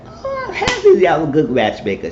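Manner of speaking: very high put-on squeal; teasing girly voice. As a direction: tease her about her crush. Same woman gushing in put-on voice.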